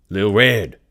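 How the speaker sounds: loud, sharp call through the door; shout. Acted. Tobias pounding on Red's door, shouting her name.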